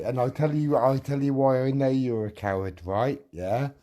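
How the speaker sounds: loud, furious rebuttal; real anger, yelling accusations; angry shouting. Real, not acted. Shouting insults in a feud.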